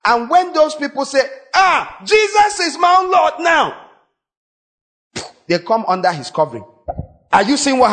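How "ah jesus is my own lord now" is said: high, ringing cry of a new believer; joyful convert. Retold or imitated. Preacher voicing converts shouting their new faith, high and joyful. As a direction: exclaim it high, overjoyed.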